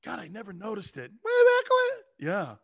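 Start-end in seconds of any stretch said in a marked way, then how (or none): 1.1-2.3 very high, drawn-out cartoon squeak; Mickey Mouse. Shown as a demonstration. Host laughing at own squeaky voice, high cartoon squeak. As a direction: stretch it out in a tiny mouse voice. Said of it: "I'm like a Mickey Mouse".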